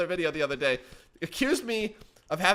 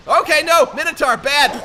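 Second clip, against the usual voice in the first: loud, high cry of alarm; high-pitched panicked warning; alarmed shout; 11 semitones higher, 12 dB louder than usual. Real, not acted. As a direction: yell out at a sudden threat. Sudden attack in the game.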